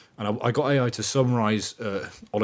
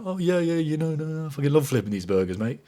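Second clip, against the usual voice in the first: mock-cheery burger flipper, voice up high; sarcastic happy worker; 7 semitones higher than usual. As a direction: ham up fake delight at a dull job. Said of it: "What percentage of people can be like".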